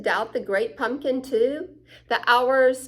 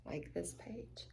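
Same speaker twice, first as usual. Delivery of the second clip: whisper; 4 semitones lower, 20 dB quieter than usual. Real, not acted. Aside while reading.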